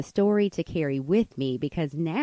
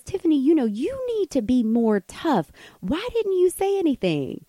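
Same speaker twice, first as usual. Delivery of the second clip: high-pitched, exasperated mock telling-off; scolding friend; 9 semitones higher than usual. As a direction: imitate a friend urging toughness. Friend scolding her for silence.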